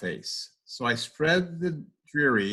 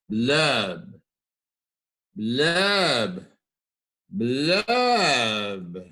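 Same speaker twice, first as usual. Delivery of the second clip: blub blub; much slower than usual. A narrator's reading. Refrain sound of fish.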